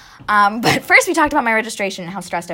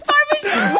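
Italian echo; 5 dB louder, slower than usual. Retold or imitated.

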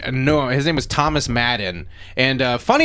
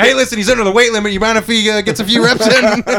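high, loud, fast sales pitch; pleading coach voice; 7 semitones higher, 6 dB louder, faster than usual. Retold or imitated. Parent begs the coach to play his big kid, high and fast. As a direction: wheedle it like a pushy parent. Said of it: "They put a play, like".

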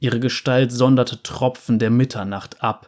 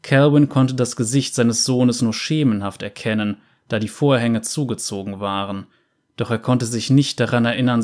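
plain reading voice

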